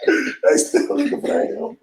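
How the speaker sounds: high, laughing repeat of the joke; a high, giggling jab at a friend; laughing tease. Retold or imitated.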